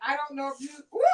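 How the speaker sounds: ordinary talk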